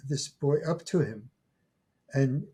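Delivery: ordinary talk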